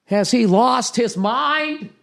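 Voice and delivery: high, slow, breathless mock shock; mock gasping gossip. Mocking fans who fall for [name], in high drawn-out shock. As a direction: clutch your pearls, drag it out.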